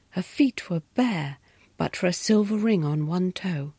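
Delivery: ordinary delivery, nothing marked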